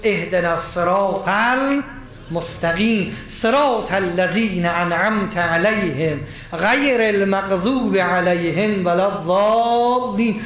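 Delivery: slow, chanted Quranic recitation; slow Arabic chant inside the sermon. Acted. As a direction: intone the verse slowly, reverently.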